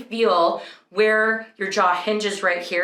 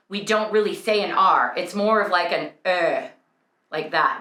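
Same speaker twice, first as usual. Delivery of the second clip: ordinary talk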